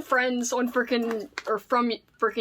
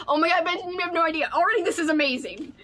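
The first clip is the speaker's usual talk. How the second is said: higher, fast gushing joy; real thrill; excited; 6 semitones higher, 3 dB louder, much faster than usual. Real, not acted. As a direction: rave about the gift. Opening the gift.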